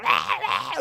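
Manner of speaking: low mock growling roar; imitated monster roar. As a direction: roar like a creature coming at you, low. Imitates monstrous thing coming up.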